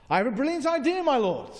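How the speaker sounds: high-pitched eager courtier pitching an idea; eager advisor. Acted. Drunken advisor pitches his plan to the king, eagerly. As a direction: pipe up keenly, high at the start.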